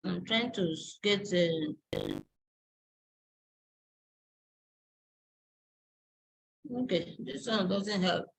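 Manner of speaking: low, quiet self-talk while searching; muttering to self. Real, not acted. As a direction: talk softly to yourself as you hunt for something. Searching for an example, talking to self.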